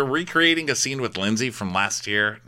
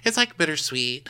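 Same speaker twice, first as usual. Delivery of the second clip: put-on girly voice; 7 semitones higher than usual. Voicing Rachel, girly.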